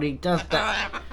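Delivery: normal talking voice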